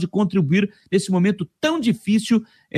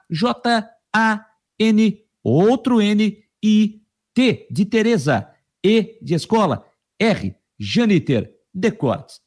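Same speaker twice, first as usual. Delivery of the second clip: letra por letra, bem pausado; soletrando devagar; slower than usual. Shown as a demonstration. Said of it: "eu vou soletrar pra você". (soletrando o nome devagar)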